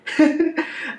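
bright burst of laughter at his own slip; quick embarrassed laugh; short laugh. Real, not acted.

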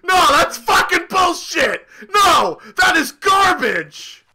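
indignant shouting, last phrase stretched out; real gamer outrage; angry protest. Real, not acted. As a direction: shout it down as totally unfair. Protesting an unfair move in game.

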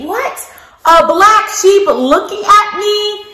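high, hammed-up astonishment; playful amazed question; mock surprise. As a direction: pretend you can't believe it for the kids.

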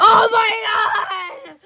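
high drawn-out laughing shriek; laughing shout. Real, not acted. Scream after the scream.

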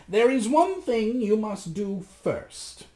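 high, slow, commanding magician; measured magician's voice, pitched high. A narrator's reading. Magician sets Rasmus a condition, high and slow. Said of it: "said the magician".

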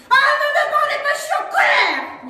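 loud, high, fast yell; angry shout. Acted. Cali storms past her family, shouting high and loud. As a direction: bark it at the family.

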